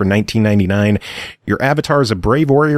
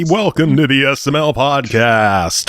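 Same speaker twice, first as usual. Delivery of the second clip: radio host voice; 2 semitones higher than usual. Pretend. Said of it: "radio voice".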